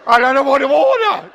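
high, loud flustered outburst; mock panic. Newcomer to America stuck in a deli line, mock panic. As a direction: wail it in comic helplessness.

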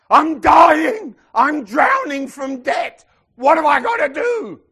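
high, loud, slow desperate shout; desperate cry. Retold or imitated. Debtor begging the debt doctor for help, desperate shout. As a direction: plead for rescue from drowning debt.

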